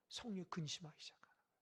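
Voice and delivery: quick, whispered aside; hushed aside. Acted. Preacher telling the congregation how the Spirit grieves, whispered. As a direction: drop to a rushed whisper as if sharing a secret.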